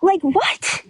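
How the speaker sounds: high, baffled inner voice; replaying a shocked thought at night; stunned disbelief. Recalling lying in bed stunned, baffled high voice. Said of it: "I'm laying in bed last night thinking".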